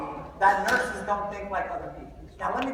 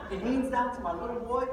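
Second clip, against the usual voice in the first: normal talking voice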